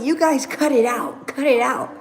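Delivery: mock-scold like a tired mom; lightly voiced mother telling kids to stop; imitating mom. Mom breaking up the fight.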